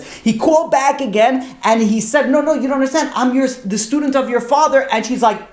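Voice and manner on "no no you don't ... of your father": high-pitched, urgent protest in a retelling; insistent pleading. Retold or imitated. Student pleading with his rabbi's daughter by phone, retold insistently. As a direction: act out urgently setting someone straight.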